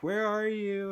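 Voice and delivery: high, loud desperate call; crying call. Retold or imitated. Looking for her through the apartment, a high tearful cry. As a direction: call out searching in tears. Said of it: "I was crying".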